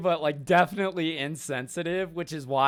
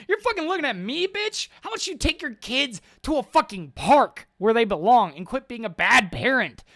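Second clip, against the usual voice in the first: fast, high, furious retort; angry confrontation; 7 semitones higher than usual. Retold or imitated. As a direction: snap at him fast and high. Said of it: "I'm like".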